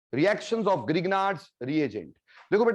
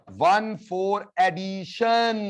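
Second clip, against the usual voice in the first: very slow, each word of "वन four addition" held long; drawn-out drilling; much slower than usual. Shown as a demonstration. Teacher repeating the term after asking the class, drawn-out drilling.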